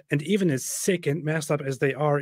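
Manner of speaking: normal talking voice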